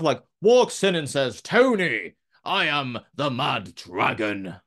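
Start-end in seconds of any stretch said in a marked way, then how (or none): 1.4-4.5 slow, grand, projected to the back row; theatrical stage voice. Retold or imitated. Imitating Dragunov announcing himself to Tony D, theatrically slow. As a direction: declaim it like a stage villain making an entrance. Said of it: "projecting to the back of the room".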